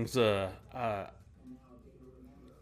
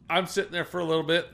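normal talking voice

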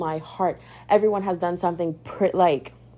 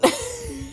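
laugh. Real, not acted. Laughs after leave me alone.

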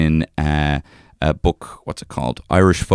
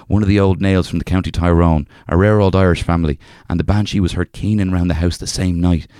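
reading a folk tale in a lightly put-on Irish accent. Reading an old folk tale to a co-host, in a light Irish accent. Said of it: "can I just say that accent".

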